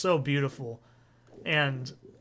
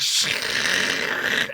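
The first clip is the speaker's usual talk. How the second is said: loud hissing oil noise; imitated sizzle; 4 dB louder than usual. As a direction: imitate crab cakes falling apart in hot oil. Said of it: "They're just going like".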